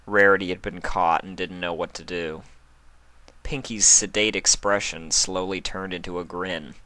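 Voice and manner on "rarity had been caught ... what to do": ordinary delivery, nothing marked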